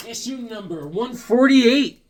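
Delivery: high, drawn-out issue announcement; announcer-style callout. Real, not acted. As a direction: sing out the number like a hype man.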